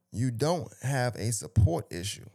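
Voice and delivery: slow, measured quote from a post; deliberate read-out. Real, not acted. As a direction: read it out word by word, slowly. Reading the post out, then repeating.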